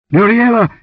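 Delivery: high, loud calling out; sudden shout. A narrator's reading. Warrior searches for the vanished dancer, shouting. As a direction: bellow her name in alarm. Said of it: "he shouted suddenly".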